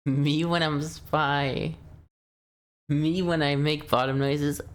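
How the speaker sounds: a hint of a whiny, crying mock of the friend, drawn out slowly; mocking friend's voice. Streamer mocks friend's strange mic voice, a light whiny mock. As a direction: lightly tease the friend's odd voice, half-sobbing. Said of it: "That's what you sound like".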